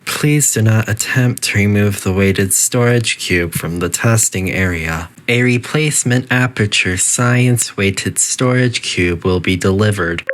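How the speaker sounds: calm, even automated announcement voice; a lightly voiced facility intercom; facility announcer. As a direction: read it like a polite recorded PA system.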